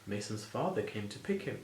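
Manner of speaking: plain reading voice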